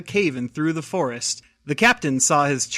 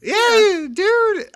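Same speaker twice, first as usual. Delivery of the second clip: high-pitched voice; 3 dB louder than usual. Real, not acted. High mock reply.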